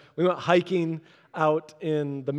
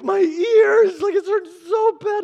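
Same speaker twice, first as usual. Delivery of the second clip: put-on much higher, louder whining boy voice; mock whiny kid; 13 semitones higher, 7 dB louder than usual. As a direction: play the hurting kid, whining. Boy with earache complaining.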